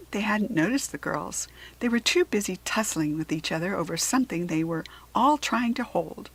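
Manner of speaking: ordinary delivery, nothing marked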